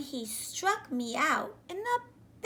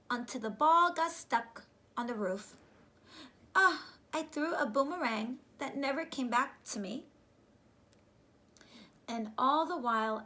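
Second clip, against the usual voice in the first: ordinary delivery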